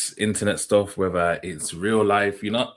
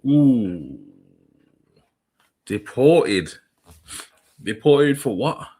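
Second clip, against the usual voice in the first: mock surprised; 5 dB louder, much slower than usual. Real, not acted. Host reacting to the deportation threat, loud mock disbelief. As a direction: act like you can't believe what he just said.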